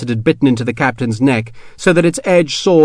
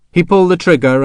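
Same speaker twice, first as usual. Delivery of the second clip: ordinary delivery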